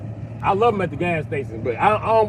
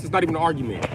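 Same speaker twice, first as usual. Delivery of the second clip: ordinary talk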